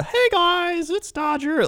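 very high-pitched mock greeting; Dodger. Showing off impressions of colleagues, very high. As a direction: squeak out a colleague's show intro. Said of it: "I got everybody. They got the".

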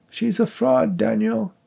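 fake female voice. A narrator's reading. Psychic fakes the dead wife's voice, high falsetto. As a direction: put on a high ghostly wife. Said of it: "his attempt at a female voice".

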